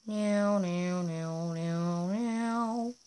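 playful meowing; cat meow. Pretend. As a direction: mew like a kitten. Cat noises. (kedi miyavlaması)

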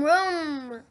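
ordinary delivery, nothing marked

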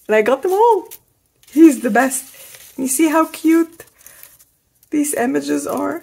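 high, loud happy gush; thrilled gushing. Real, not acted. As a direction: burst with joy over the gift. Thrilled about son's gift.

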